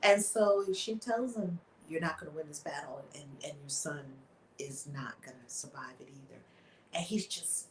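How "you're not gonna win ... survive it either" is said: low and much softer, sinking away; solemn warning. Retold or imitated. Witch telling the king his son will die, hushed and solemn. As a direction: let the verdict fall to a hush.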